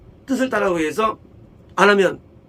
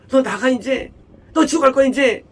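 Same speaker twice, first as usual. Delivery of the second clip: higher, louder furious outburst; angry spouse; 6 semitones higher, 4 dB louder than usual. Retold or imitated. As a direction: hurl it like a fed-up spouse. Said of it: "열받으면". (화난 배우자의 고함)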